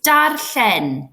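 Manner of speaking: darllen with the double L breathed out at the side of the mouth; Welsh double L. Shown as a demonstration. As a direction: slowly model the ll in darllen. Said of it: "side of your mouth here and blow out".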